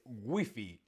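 "wifi" hispanicised as WEE-fee; Spanish pronunciation. Shown as a demonstration. Teacher revealing the correct answer, Spanish pronunciation. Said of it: "pues la correcta es wifi".

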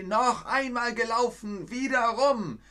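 very high strained shriek; furious scream. Acted. The furious hare screams for another race, very high. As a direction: shriek it, beside yourself with rage. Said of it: "ganz außer sich vor Ärger, schrie".

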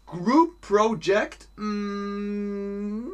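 high, drawn-out reluctant voice; slow high question, then a long doubtful hum; acted social loafer. Teacher playing a group-work shirker, high reluctant drawl. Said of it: "Oder hast du soziales Faulenzen?".

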